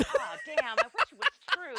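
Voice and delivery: high, loud, laughing disappointment; excited. Real, not acted. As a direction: cry out in laughing dismay. Disappointed at the made-up fact.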